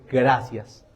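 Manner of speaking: low, soft retold reply; subdued thanks. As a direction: say thanks quietly, as if recounting it. First, restrained take of his thanks.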